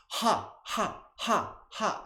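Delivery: high, short ha bursts on the exhale; breathy ha sounds. Shown as a demonstration. Teacher demoing laughter breath, high ha bursts. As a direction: push each ha out high. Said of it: "So it's gonna sound like this".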